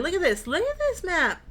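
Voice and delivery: high, eager delight; excited. Real, not acted. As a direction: gush over the map. Excited at map.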